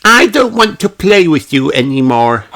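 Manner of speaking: put-on high sulky child voice; sulky refusal. A narrator's reading. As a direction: pout and refuse to play. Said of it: "says Peppa".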